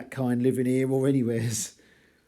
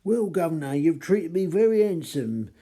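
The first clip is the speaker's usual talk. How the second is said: higher, louder Cockney cabby voice; Cockney reading; 5 semitones higher, 5 dB louder than usual. Acted. Teacher reads the informant's Cockney line aloud, in a Cockney accent. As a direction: read it as a chirpy Cockney informant. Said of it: "attempts at replicating Cockney dialect".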